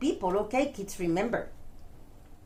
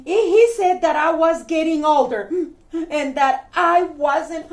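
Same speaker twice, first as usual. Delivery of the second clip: sobby, louder, halting complaint; mock tearful; 3 semitones higher, 5 dB louder than usual. Bug girl repeats her boss's words, mock crying. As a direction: blubber through it, hammed-up. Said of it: "I'm crying".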